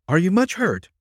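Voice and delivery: high-pitched, worried asking; anxious question. A narrator's reading. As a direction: ask anxiously after an injured friend. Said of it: "asked Patsy anxiously".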